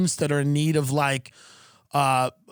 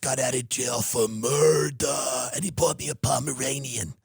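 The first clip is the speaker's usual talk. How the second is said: low, softer Mob Wives tough-talker voice; Big Ange impression; 3 semitones lower, 3 dB quieter than usual. To the co-host, doing Big Ange from Mob Wives, low and soft. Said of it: "impression I can really do is a character named Big Ange".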